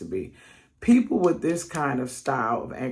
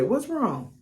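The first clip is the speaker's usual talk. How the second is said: caring question; 9 semitones higher than usual. Retold or imitated. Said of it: "hoping the other person gonna say".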